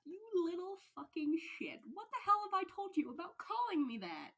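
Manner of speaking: loud, high furious yell; angry yell. Acted. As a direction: chew him out for the nickname. Teacher yells at former student.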